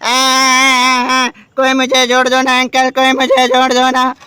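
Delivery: mock baby whine pleading for help; crying baby doll. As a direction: play the broken doll begging to be fixed, half crying. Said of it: "ये रो रहा है बाबू".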